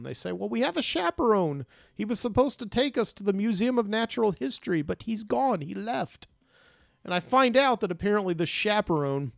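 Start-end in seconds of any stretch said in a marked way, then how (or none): 0.3-6.3 lightly voiced upset kids, higher; distressed children. Kids telling a stranger their chaperone left, mildly distressed higher voice. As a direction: plead like a worried child, pitched up a little.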